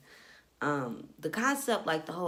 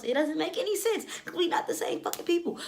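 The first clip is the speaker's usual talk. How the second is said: much higher, incredulous; exasperated; 11 semitones higher than usual. Real, not acted. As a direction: push it up in disbelief. Exasperated rant.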